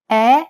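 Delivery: Italian "E" as open "eh", mouth wide. Shown as a demonstration. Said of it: "Compare the open E followed by a closed E".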